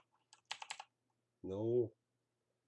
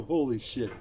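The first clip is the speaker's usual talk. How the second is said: usual speaking voice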